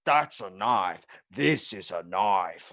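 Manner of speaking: very loud, slow, hammed-up Aussie; booming mock-Australian movie line; Australian accent. Shown as a demonstration. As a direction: proclaim it in a broad Australian drawl. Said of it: "it end up being like".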